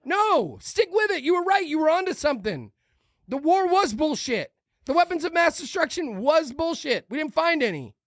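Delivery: high-pitched, insistent ranting; worked-up rant. Real, not acted. Host urging war critics to stick with it, heated rant. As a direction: plead heatedly, pitched well up. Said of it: "not screaming at the camera".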